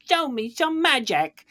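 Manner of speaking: put-on high childish voice with a lisp; little girl voice. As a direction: play a lisping little girl. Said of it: "cute little speech impediment".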